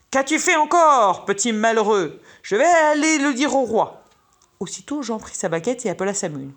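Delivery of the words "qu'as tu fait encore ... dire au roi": loud, high, exasperated shout; angry outcry. A narrator's reading. Gardener finds carrots torn out, angry outcry. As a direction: cry out in outrage at the boy. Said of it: "il s'écria".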